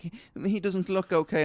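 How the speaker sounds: high, fussy, worried mock voice; posh passer-by. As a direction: squeak it out like a fretting dog owner.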